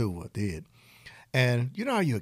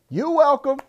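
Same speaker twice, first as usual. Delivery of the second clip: playful; 5 dB louder than usual. Acted. Playful sign-off to promo.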